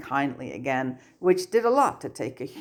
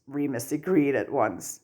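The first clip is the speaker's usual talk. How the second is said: plain reading voice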